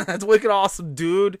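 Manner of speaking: loud, high mock Boston bro; hammed-up Boston enthusiasm; Boston accent. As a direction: mimic a thick Boston accent. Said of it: "a wicked thick Boston accent".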